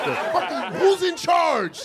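high, slow, incredulous squawk; imitating his own rattled self; mock flustered outrage. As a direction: lose your cool in disbelief. Flustered question to the cops.

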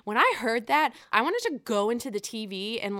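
ordinary delivery

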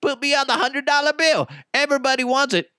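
high-pitched mock boasting. Rapper demanding his face on the bill, mock bragging. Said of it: "He'd be like".

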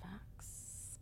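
breathy whispered agreement. Real, not acted. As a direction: whisper it under your breath. Quiet agreement aside.

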